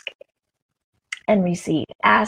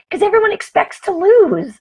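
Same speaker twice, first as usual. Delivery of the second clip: high, louder bright retort; excited retort; 11 semitones higher, 5 dB louder than usual. Retold or imitated. Explaining to the store lady, high excited retort. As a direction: proudly explain. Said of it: "I said".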